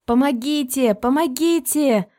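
loud desperate cry for help; shouting for help. A narrator's reading. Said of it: "закричала я".

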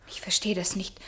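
soft whisper, bewildered; hushed bewildered whisper. Acted. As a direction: breathe it out, lost. Confused, hurt reply.

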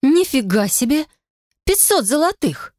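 high indignant outburst; angry outburst. A narrator's reading. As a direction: blurt it out in outrage. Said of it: "вырвалось гневное".

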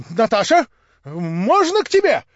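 calling out high and loud with forced courage; a staged brave shout through the door; put-on bold cry. A narrator's reading. Young man at Natasha's closed door, forced bold cry. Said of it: "вскрикнул он с какой-то выделанною смелостью".

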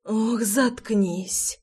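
very slow, drawn-out plea, lightly whimpered; mild whimper. A narrator's reading. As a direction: whimper it gently, stretching each word. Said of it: "захныкала Руслана". (слегка хныча, очень протяжно)

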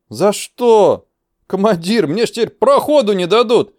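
high, loud theatrical lament; tragic wailing protest. Acted. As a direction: wail it like the world is ending. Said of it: "Капитан трагически заламывал руки".

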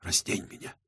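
very soft, low whisper; whispered order. A narrator's reading. Man to his lover after a kiss, whispered order. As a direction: give the command in a hushed whisper. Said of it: "шёпотом приказал".

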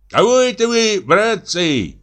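high-pitched shepherd's call. A narrator's reading. Shepherd asking the fishermen what they catch, calling out. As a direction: call over to them from the bank, high. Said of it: "кричит Ефим".

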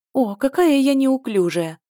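high, slow, loud flustered cry; exclaiming. A narrator's reading. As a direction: exclaim it like a clumsy girl. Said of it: "воскликнула она".

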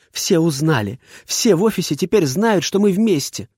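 put-on high, agitated girl's voice; distressed girl. A narrator's reading. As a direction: blurt it out, foot stamping. Heroine stamps her foot.

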